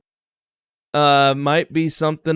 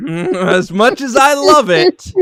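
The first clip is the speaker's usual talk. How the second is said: laughing; 6 semitones higher, 4 dB louder, slower than usual. Real, not acted. Laughing while admitting ranking.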